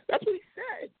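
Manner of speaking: "what" leapt up high and hit hard; stress on what. Real, not acted. Host stressing "what" to his co-host on air, high and pointed. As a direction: punch "what" sharply upward in disbelief. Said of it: "emphatic punchline".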